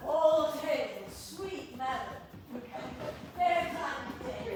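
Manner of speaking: loud, slow, laughing mock hail; mock greeting laughing. Courtier greets the ladies, loud and laughing. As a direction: hail her grandly while cracking up.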